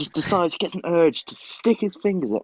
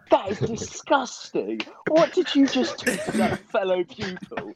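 outraged visitor; 7 semitones higher than usual. Retold or imitated. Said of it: "He was like".

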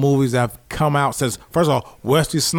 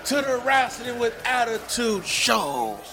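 high, slow shouted announcement; announcer hype; 10 semitones higher, 6 dB quieter, slower than usual. Acted. Show intro drop, high slow announcer shout.

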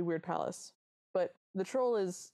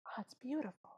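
soft, higher near-whisper; awed whisper; 7 semitones higher, 7 dB quieter than usual. Acted. Anya turns and sees the palace, sort of whispered. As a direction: breathe it out softly in wonder. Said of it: "Anya sort of whispers".

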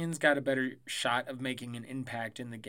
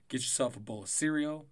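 normal talking voice

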